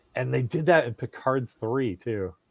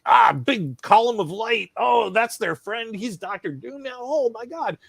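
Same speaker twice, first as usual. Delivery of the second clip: put-on awed six-year-old; 9 semitones higher, 3 dB louder than usual. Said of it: "So he's just like".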